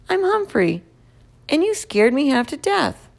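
a touch of a small excited hamster. A narrator's reading. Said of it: "I told him".

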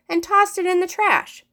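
plain reading voice

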